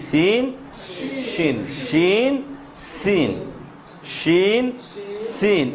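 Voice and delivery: sin and shin alternated, the mouth kept natural; letter drill. Shown as a demonstration. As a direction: contrast sin and shin back and forth. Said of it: "পঞ্চম ভাগের এ হলো দুইটা বর্ণ".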